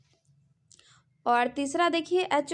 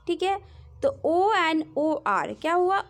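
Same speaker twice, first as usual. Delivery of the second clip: normal talking voice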